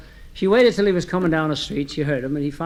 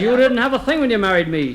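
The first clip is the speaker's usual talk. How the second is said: higher-pitched mock reproach; scolding wife; 6 semitones higher, 3 dB louder than usual. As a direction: scold a husband, acted and higher. Wife's acted quarrel with husband.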